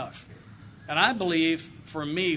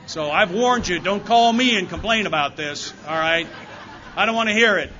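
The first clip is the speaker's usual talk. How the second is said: loud, half-joking scolding of the room that ends in a laugh; mock stern warning; 5 semitones higher, 12 dB louder than usual. Speaker to the meeting, teasing them not to complain, in a loud mock-stern voice. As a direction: playfully warn them off, loud and higher, not really angry.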